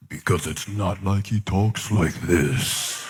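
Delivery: a mock divine voice, deep and drawn out; deep God voice. Shown as a demonstration. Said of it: "I don't even know what that was".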